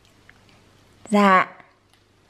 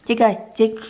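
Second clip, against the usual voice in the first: normal talking voice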